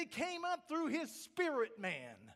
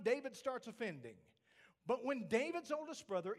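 ordinary delivery

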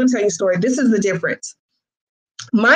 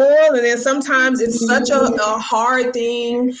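ordinary talk, nothing marked

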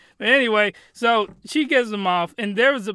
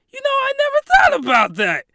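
put-on high, louder girlish voice; mock girl voice; 6 dB louder than usual. Retelling the succubus's reply, high mock girl voice. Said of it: "she's like".

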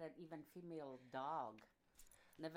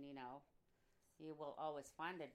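ordinary talk, nothing marked

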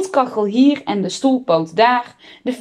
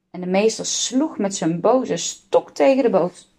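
ordinary delivery, nothing marked

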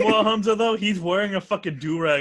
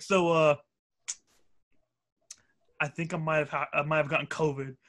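deadly serious; 3 semitones lower, 5 dB quieter than usual. Retold or imitated. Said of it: "Just, like, hella deadly serious and shit".

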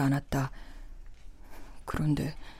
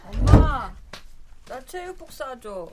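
higher whiny pleading; whining younger daughter; 9 semitones higher than usual. Acted. Younger daughter whines for gym clothes.

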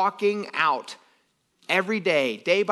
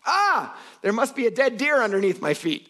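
much higher, put-on startled voice; imitating Bear Grylls; 8 semitones higher than usual. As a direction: ham up the disgusted discovery. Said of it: "he's like".

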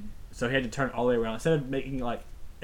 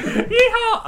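high, loud cowboy holler; rebel yell; 10 dB louder than usual. Real, not acted. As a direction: belt out a cowboy yeehaw. Cowboy yell after the whoop.

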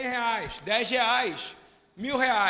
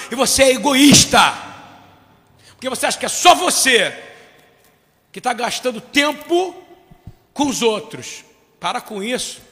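loud, rousing preacher's cry; full-force preaching; 2 semitones higher, 10 dB louder than usual. Acted. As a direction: thunder it out to the congregation.

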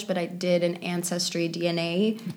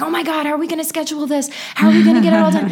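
panicked director; 9 semitones higher, 5 dB louder, faster than usual. Retold or imitated. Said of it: "He was like".

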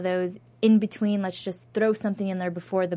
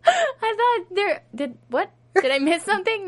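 laughing; 11 semitones higher than usual. Real, not acted. Laughing disbelief.

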